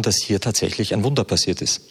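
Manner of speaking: ordinary talk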